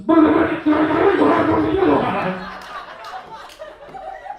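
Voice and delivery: loud, high smothered shouting noise; muffled mumbling yell. Retold or imitated. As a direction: yell into thighs so no words come out. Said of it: "all she could hear mumbling between her thighs was".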